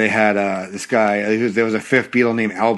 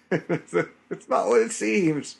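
quoted movie line; 8 semitones higher, 7 dB quieter, much slower than usual. Retold or imitated. Quoting Austin Powers line.

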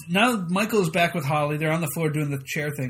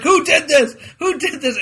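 higher, louder shouted question through a laugh; Michael yelling at the office; mock yelling; 9 semitones higher, 7 dB louder than usual. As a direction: yell it at the whole office. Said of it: "he starts yelling out at the office".

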